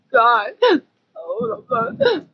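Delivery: loud, nearly crying; real shaken near-sob. Real, not acted. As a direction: gasp it out in shock. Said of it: "I'm having a heart attack".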